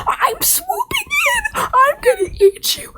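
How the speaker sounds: very high, piercing attack screech; shrill bird-of-prey threat; screeching vulture. Acted. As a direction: shriek it as a diving vulture.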